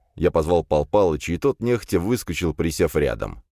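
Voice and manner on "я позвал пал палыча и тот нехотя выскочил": usual speaking voice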